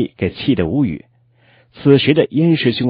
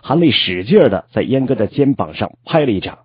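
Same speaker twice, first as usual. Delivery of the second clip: usual speaking voice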